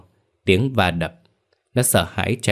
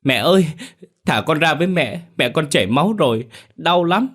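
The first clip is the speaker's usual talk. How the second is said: put-on a child begging through tears; boy crying and struggling to get back to his bleeding mother; 8 semitones higher than usual. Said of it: "khóc lóc giãy giụa đòi mẹ".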